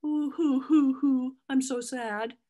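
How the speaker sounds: high hammed-up fake boo-hoo crying; mock sobbing. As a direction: pretend to blubber, over the top. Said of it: "You know, that's not".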